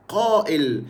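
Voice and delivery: قائل said "qa'il" with q and a glottal break; standard Arabic qaf and hamza. Shown as a demonstration. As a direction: keep both q and hamza in قائل. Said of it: "في اللغة العربية الفصحى". (قائل بالفصحى، بالقاف والهمزة)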